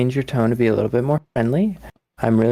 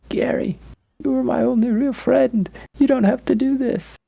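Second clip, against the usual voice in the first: a begging falsetto as he escapes; high pleading; 13 semitones higher than usual. Acted. Rachel begs fleeing Gary in high desperate voice. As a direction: pitch the plea far up, slow and desperate.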